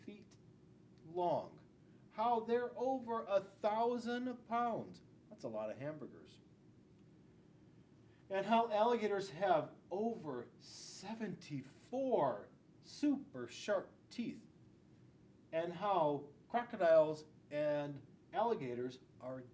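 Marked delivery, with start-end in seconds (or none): none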